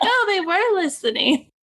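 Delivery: high, laughing realization; laughing surprise. Retold or imitated. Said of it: "And I was like".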